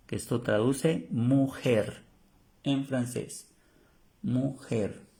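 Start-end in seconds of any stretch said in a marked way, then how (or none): none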